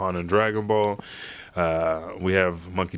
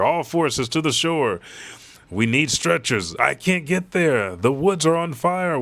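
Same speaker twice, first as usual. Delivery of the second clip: soldiers calling out; 7 semitones higher than usual. Retold or imitated. Soldiers calling across burning island.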